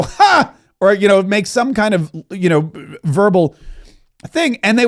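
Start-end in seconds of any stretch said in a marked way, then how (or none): 0.0-0.5 sharp, high single scoffing laugh; a scornful burst of laughter; loud scoffing laugh. Retold or imitated. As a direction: bark out one mocking ha. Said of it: "or I would go".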